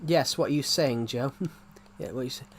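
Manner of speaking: normal talking voice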